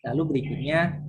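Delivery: ordinary talk, nothing marked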